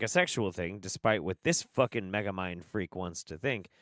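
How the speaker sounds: usual speaking voice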